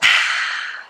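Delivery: breathy "ahh" after a drink; satisfied sigh. Pretend. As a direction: exhale as if your thirst is quenched.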